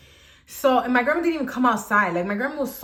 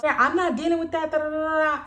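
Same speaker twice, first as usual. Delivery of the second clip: dismissive mom; 7 semitones higher, faster than usual. Retold or imitated. Mom refusing, annoyed.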